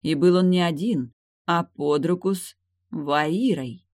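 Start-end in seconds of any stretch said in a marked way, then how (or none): none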